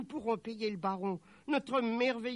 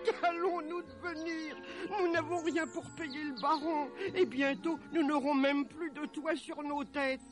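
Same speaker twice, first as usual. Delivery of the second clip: high-pitched tearful wail; acted, despairing sobs through the words; sobbing mother; 11 semitones higher than usual. A narrator's reading. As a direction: lament your ruin in a high, weepy voice. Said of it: "en éclatant en sanglots".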